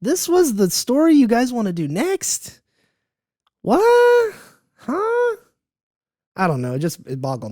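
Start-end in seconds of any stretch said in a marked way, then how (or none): 0.0-5.4 high, incredulous questions climbing to a squeak; frustrated disbelief. Acted. Questioning the choice of story to do next, in frustrated disbelief. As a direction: ask it in exasperated bafflement, pitch shooting up. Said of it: "it's so frustrating 'cause you're like".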